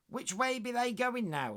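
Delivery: high, loud West Country voice; West Country maid. Acted. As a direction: call up from the window, West Country. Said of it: "Trying to do a West Country accent there".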